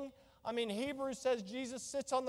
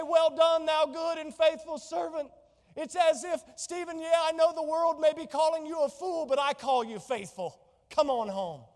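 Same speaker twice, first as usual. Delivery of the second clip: proud praise; 5 semitones higher, 8 dB louder than usual. Pretend. Jesus praising faithful servant.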